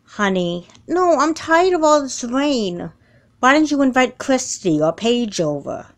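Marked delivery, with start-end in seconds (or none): 0.9-2.9 whiny complaint. Acted. Teen whining to mom.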